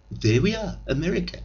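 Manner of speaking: high, lightly voiced proud America; mock proud American. Comic mocks America announcing itself, high mock pride. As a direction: announce it like a newborn nation. Mocking young America's birth.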